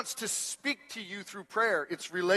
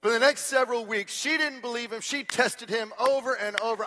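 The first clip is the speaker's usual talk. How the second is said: normal talking voice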